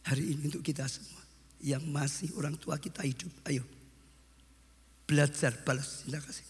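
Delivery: ordinary talk, nothing marked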